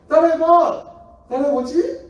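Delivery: high, loud taunting dare. Retold or imitated. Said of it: "이렇게 했는데".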